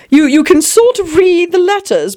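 ordinary delivery

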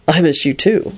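understated lover's reply; boyfriend voice. A narrator's reading. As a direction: answer your sweetheart in a lightly voiced man's voice. Boyfriend answers on the phone.

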